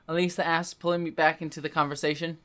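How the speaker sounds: ordinary talk, nothing marked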